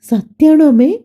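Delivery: put-on high, thrilled little-girl question; excited child. A narrator's reading. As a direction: ask it bursting with joy. Child hears of baby. (ഉയർന്ന സ്വരത്തിൽ ആവേശത്തോടെ ചോദിക്കുന്ന കുട്ടി)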